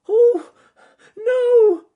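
very high, loud anguished cry; shouted cry. Acted. Rabbit seeing his friend swept away, loud high cry. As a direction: cry out in horror. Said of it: "shouted Ernest Fluffy Rabbit".

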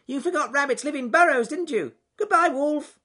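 triumphant high rabbit yell; shouted taunt. Acted. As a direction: crow over the outwitted wolf, loud and squeaky. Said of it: "shouted Ernest Fluffy Rabbit".

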